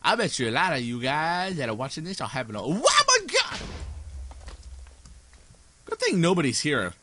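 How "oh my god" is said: high shriek of fright; startled scream, much higher; sudden scream of surprise; real scream. Real, not acted. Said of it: "to hear me scream".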